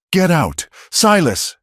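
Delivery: high, urgent yell. A narrator's reading. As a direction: yell at him to flee. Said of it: "she yelled".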